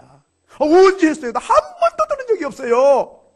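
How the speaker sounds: 매우 높고 크게 외치는 항의; 들은 적 없다고 목청 높여 우겨라; 억울한 항변. Acted. 젊은이가 염라대왕에게 항변. (젊은이가 염라대왕에게 높은 목소리로 항변함)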